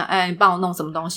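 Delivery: offhand order with a rise on 帮; blunt demand. Shown as a demonstration. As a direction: toss off 哎，你帮我弄什么东西 like a casual command. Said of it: "可是你看啊". (随口使唤别人)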